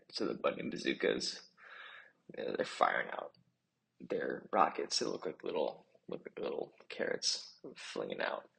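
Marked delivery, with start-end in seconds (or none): none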